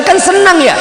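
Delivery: high-pitched comic complaint; mock indignation. Poor man promised an outing then let down, mock indignant. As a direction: protest high, falling at the end.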